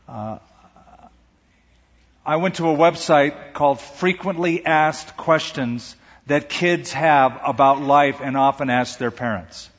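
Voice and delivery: usual speaking voice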